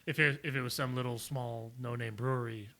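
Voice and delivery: ordinary talk, nothing marked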